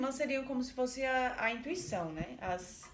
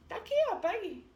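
nervous high wife; 11 semitones higher, 6 dB louder than usual. Retold or imitated. Esposa entrega as chaves nervosa.

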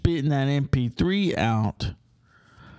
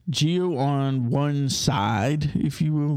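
ordinary delivery

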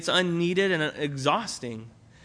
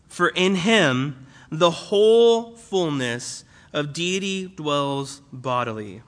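slow, weighty reading of the verse; scripture reading; 2 semitones lower, slower than usual. Acted. Pastor reading the verse to the church, slow and solemn. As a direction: read it slowly, letting each word land.

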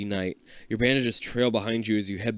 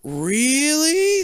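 long, much higher and louder "Really?"; a hammed-up drawn-out doctor; 5 dB louder than usual. A narrator's reading. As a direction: stretch it way up in disbelief. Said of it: "the other doctor says slowly".

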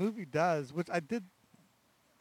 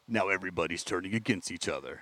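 movie trailer voice; 6 semitones lower than usual. Retold or imitated. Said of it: "that's the movie thriller space voice".

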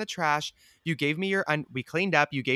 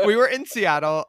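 laughing; 5 semitones higher, 6 dB louder than usual. Real, not acted. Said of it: "laughing repeat of the city".

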